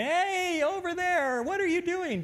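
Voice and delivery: high, loud call across a noisy room; calling out loudly. Shown as a demonstration. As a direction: shout to a friend a few chairs away. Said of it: "you'll catch yourself involuntarily going".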